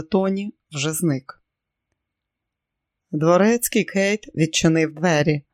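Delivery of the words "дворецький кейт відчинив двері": ordinary delivery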